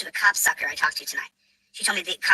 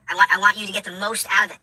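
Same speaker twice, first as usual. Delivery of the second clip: ordinary talk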